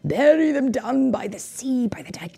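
commanding villain. A narrator's reading. Villain ordering the page boy, urgent and secretive. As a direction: command him under your breath. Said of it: "commanded Lord Droon".

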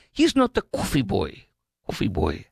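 imitating a wife's Greek accent; mock Greek accent, indignant. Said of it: "she had, like, a Greek or foreign accent".

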